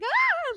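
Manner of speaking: high-pitched little scream, held back; squealed mock shriek. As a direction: imitate your own scream without full volume. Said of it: "but I screamed".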